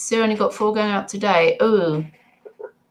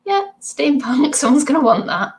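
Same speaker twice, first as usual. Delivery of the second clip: laughing quote; 8 semitones higher, 4 dB louder than usual. Retold or imitated. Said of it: "And I was like".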